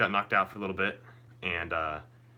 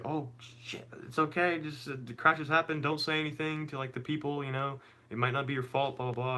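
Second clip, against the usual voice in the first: higher-pitched imitation of a mother; reassuring mom; 7 semitones higher than usual. Mom calming her son after a crash, higher reassuring voice.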